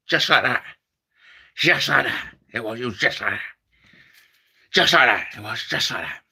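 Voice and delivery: low, growly repeated catchphrase; Tommy Cooper. Retold or imitated. Comic repeating Tommy Cooper's catchphrase, low. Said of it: "my Tommy Cooper impression".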